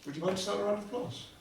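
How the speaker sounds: normal talking voice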